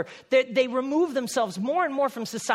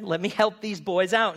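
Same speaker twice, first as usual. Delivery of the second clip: ordinary talk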